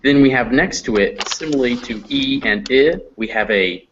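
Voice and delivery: usual speaking voice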